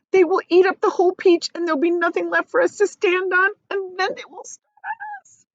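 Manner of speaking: high, loud frantic pleading; panicked. Acted. Miss Spider fearing the sharks, high and frantic. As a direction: rush it out in terror.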